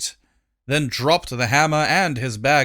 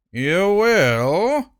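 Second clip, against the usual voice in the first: suspicious high question; 5 semitones higher, 4 dB louder than usual. Acted. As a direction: doubt him openly.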